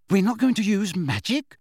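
put-on boy shouting out in class; loud outburst. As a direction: burst out in disbelief. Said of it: "Ron ejaculated loudly".

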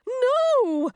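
high, loud anguished wail. Acted. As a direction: cry it out in despair. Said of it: "wailed the nymph".

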